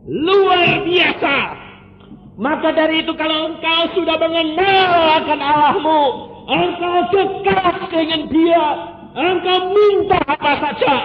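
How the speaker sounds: loud, high, fast sermon cry; fiery preaching. Acted. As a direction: preach it out at full force. Preacher exhorts the congregation. (khotbah berapi-api dengan suara keras)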